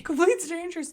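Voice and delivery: teasing echo. Real, not acted. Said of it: "you knew that lady".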